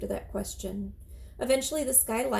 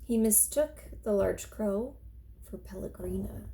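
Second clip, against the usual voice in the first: usual speaking voice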